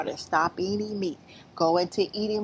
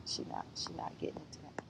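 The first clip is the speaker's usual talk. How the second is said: breathless secret whisper to herself; whisper aside; 17 dB quieter, faster than usual. Real, not acted. Muttering to herself that she's dropping the topic, whispered.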